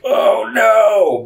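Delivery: high, drawn-out mock wail; mock dismay. Viewer dreading the next sequels, mock dismay. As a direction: cry out in hammed-up dread.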